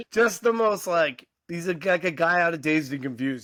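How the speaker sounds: ordinary talk, nothing marked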